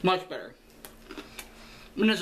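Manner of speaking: talking between bites; chewing while talking. Real, not acted. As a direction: mouth partly full. Seen 4: Bites food at -4, cheeks and lips still chewing while speaking.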